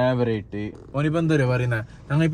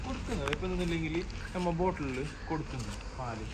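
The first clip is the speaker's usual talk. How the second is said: hushed; 6 dB quieter than usual. Real, not acted. Baby asleep in car.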